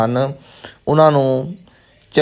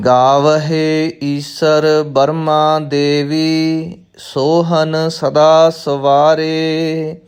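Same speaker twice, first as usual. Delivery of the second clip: measured chant-like recital with drawn-out line endings; scripture recitation; 3 semitones higher, much slower than usual. Shown as a demonstration. Teacher reciting Gurbani for new students, measured chanted recitation. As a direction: recite slowly on a level, sustained tone.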